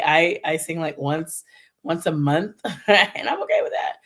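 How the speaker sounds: ordinary talk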